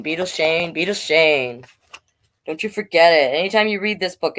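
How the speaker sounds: ordinary delivery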